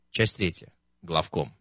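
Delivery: plain reading voice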